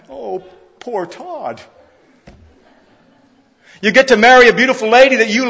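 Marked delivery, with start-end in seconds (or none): 0.1-1.6 slow, soft, drawn-out sarcastic sigh of pity; mock pity. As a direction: feign sorrow for him, quietly and slowly. Mock pity for groom leaving family.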